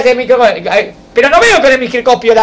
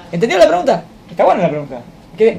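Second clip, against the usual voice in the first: normal talking voice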